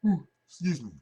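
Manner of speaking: talking through a yawn. Real, not acted. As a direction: let the yawn stretch the words. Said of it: "I apologize for yawning".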